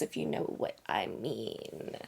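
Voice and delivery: low, slow, unvoiced knowing whisper; hint at it with a sly low whisper; suggestive. Real, not acted. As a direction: innuendo in a low slow hush. Suggestive aside.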